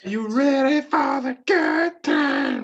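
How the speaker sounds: high, slow, drawn-out announcer call; hammed-up arena catchphrase, stretched slow; ring announcer hype. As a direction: belt it out like a wrestling entrance. Wrestling entrance catchphrase called out.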